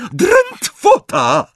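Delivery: very high, loud, drawn-out scream; shrieked spell. A narrator's reading. Bellatrix stuns the snatchers, shrieking. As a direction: shriek the curse out slowly. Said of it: "wrzasnęła".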